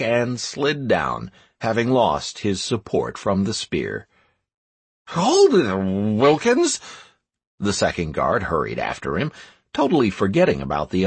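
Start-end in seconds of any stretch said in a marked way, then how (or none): 5.1-6.8 calling out. A narrator's reading. Guard calls out to comrade.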